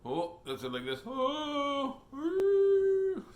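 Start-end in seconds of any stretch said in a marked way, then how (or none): 1.1-3.2 squealing ooh, much higher; a surprised falsetto ooh, louder; high whooping ooh. Pretend. Pretend skydiving face.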